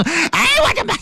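a high startled shriek of fright; scared cry. Acted. 王二看见帐子里的人，吓得尖叫. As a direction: yelp like you just saw a ghost. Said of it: "嘴里喊了一声".

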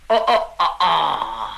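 low, drawn-out ohs; low murmur of understanding; dawning realization. A narrator's reading. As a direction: let it sink in slowly, deep in the voice. Niu Gao catches on.

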